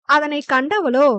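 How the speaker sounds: ordinary delivery, nothing marked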